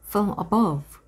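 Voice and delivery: plain reading voice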